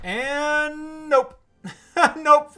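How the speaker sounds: high, loud drawn-out nope, laughing; emphatic nope. Real, not acted. Streamer answering his own chart question with a loud nope.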